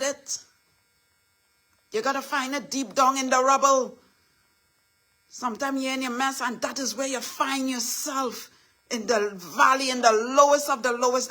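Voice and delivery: high, loud preaching build; rousing sermon climax. Acted. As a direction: preach it to the congregation, full force. Rising into preaching.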